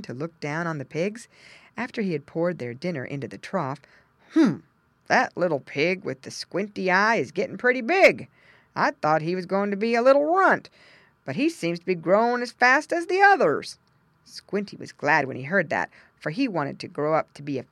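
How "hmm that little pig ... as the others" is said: louder, lightly voiced farmer; a farmer musing over the pen; a hint of a hearty farmer; farmer's voice. A narrator's reading. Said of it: "exclaimed the farmer".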